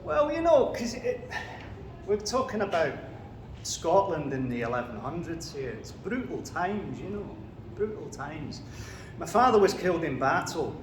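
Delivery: put-on Macbeth in a Scottish accent; broad Scottish accent. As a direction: speak as a Scottish warrior king, Scottish accent. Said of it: "Do you want a quick example?".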